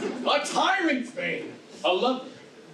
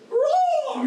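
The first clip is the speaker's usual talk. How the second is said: high loud roaring shout; lion roar; 5 dB louder than usual. Acted. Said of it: "I will roar you as gently".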